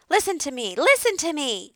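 high, loud, insistent goose cry; screaming goose. A narrator's reading. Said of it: "screamed the goose".

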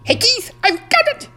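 very high, loud squeak; excited high-pitched mouse cheer; triumphant mouse. A narrator's reading. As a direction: call out the good news in a tiny voice. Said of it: "Ralph filled with triumph".

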